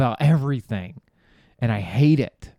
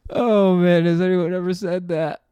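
higher, louder, gleeful; giddy delight; 7 semitones higher, 4 dB louder than usual. Real, not acted. Comic asking the crowd about his joke, giddy and higher. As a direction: savor your own joke.

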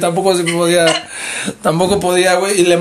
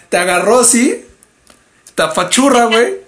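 ordinary talk

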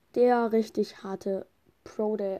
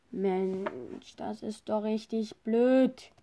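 normal talking voice

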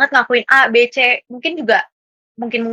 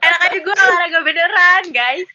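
high-pitched, mock-indignant laughing retort; laughing protest; 8 semitones higher than usual. Real, not acted. Podcast guest teased about faking her workouts, laughing protest.

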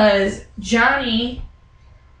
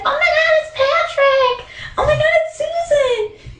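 excited squeal. Retold or imitated. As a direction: shriek like a starstruck fan. Mom spots the tattoo couple.

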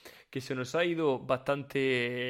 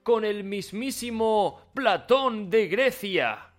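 higher, louder salesman pitch; hyped ad pitch; 4 semitones higher, 3 dB louder than usual. Acted. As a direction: oversell it like a TV pitchman. Hyped teleshopping product pitch.

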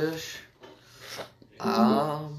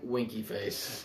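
whispered aside; 6 semitones lower, 12 dB quieter, much slower than usual. Acted. Reading the review's sign-off.

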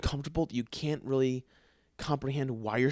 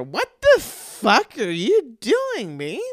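much higher, louder, slow mock-girlfriend outrage; imitating Zoe; 11 semitones higher, 9 dB louder, much slower than usual. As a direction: play the startled wife catching him, drawn out and high. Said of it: "All of a sudden, I hear".

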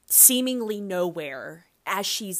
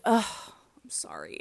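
breathy whispered ugh and sorry; whispered apology; 3 semitones higher than usual. Real, not acted. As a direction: hush it like you got caught. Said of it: "I didn't mean to say G D".

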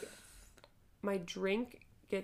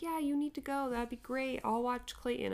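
husband quote; 6 semitones higher than usual. Retold or imitated. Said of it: "Spencer's like".

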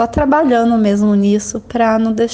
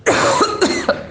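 harsh cough; choking cough; 11 semitones higher than usual. Real, not acted. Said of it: "eu engasguei feio mesmo".

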